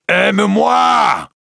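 a priest screaming his love at Esmeralda; desperate shout. Acted. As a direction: let the plea explode into a scream. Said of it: "crie le prêtre".

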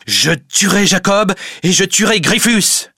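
high-pitched furious yell; mad with rage. A narrator's reading. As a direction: yell the threat, wild with anger. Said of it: "hurle Van Berle, fou de colère". (hurlement fou de colère)

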